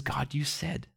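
very soft, hushed; submissive appeal. Retold or imitated. The humble doubter appealing to God, hushed. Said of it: "And the other says".

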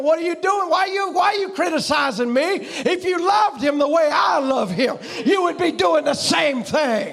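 hurling the reply at a critic, shouting; shouted retort. Retold or imitated. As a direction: fire the answer back at the critic. Squirrel's reply, shouted again.

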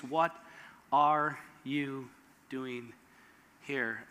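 soft, slow, low hushed question; still small voice. Retold or imitated. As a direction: ask it barely above a breath. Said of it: "in the still small voice".

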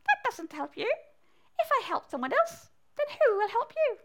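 high, squeaky frog voice. Acted. Frog questions the mouse's advice, high squeaky voice. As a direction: pipe up high as a little frog. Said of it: "said the frog".

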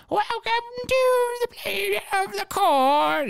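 high, slow strained scream; mock scream. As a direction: bellow it like a haunted-house actor. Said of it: "poor guy screaming".

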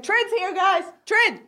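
high, cheering hype voice; hyped crowd. Pretend. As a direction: announce her like a celebrity arriving. Crowd hailing Trin's entrance.

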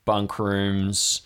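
ordinary talk

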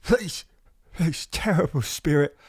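begging for my life. Acted. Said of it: "begging for his life".

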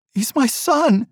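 mother cries out to husband about their son, anguished; crying out. A narrator's reading. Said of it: "she cried".

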